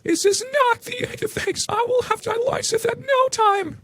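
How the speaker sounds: hammed-up high-pitched furious mother; screaming Karen. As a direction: shriek it up high like an outraged mother. Narrator voices Karen.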